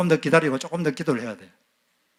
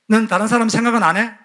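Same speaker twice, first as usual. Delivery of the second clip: higher, louder reproach; angry mother; 6 semitones higher, 4 dB louder than usual. Retold or imitated. Mother reproaching her inconsiderate child, sharp higher voice. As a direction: accuse the child, indignant. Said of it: "엄마가 화가 났어요".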